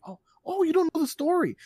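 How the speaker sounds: very high, excited mock gasp of a fan; gushing excitement. Dad to daughter who doesn't know the story, gushing excitement.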